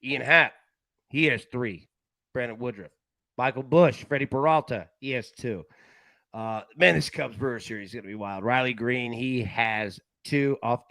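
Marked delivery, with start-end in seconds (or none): none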